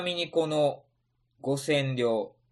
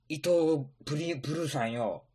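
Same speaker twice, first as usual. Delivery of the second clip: ordinary talk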